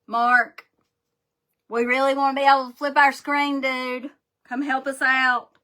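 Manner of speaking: calling out to Mark. Real, not acted. Host calls Mark to fix the flipped screen, loud pleading call. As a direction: shout across to Mark, half-joking.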